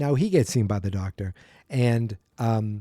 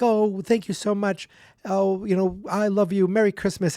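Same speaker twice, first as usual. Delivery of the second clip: high grateful voice; 7 semitones higher than usual. Retold or imitated.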